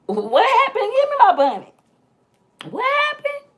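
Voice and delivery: very high-pitched, squealed demand; retold outcry in a squeaky high voice; hammed-up alarm. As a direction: squeal it in mock urgency, way up high. Acts out her urgent reaction.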